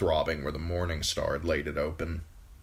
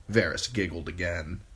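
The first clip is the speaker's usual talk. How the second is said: usual speaking voice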